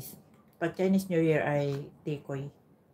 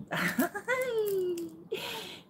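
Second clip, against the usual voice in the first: high squealing laugh. Real, not acted. Excited laugh at lucky sweets.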